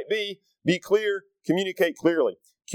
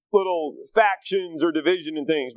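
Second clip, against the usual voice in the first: normal talking voice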